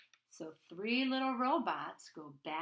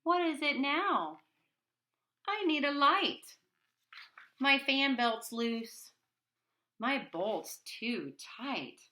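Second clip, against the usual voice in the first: annoyed; 6 semitones higher, 7 dB louder than usual. Acted. Boy annoyed, robot asks.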